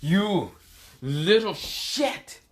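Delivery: grunt, then drag out the insult slowly; Frio's pained anger. Acted. Frio knocked up by a kick, halting angry curse. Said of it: "He's like".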